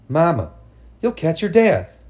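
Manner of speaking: rising alarmed pleading; alarmed plea. Acted. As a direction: warn her urgently. Girl pleads with mother.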